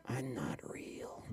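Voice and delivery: slow, hushed whisper; whispered aside. Acted. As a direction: whisper it very softly and slowly. Puppet aside in comedy act.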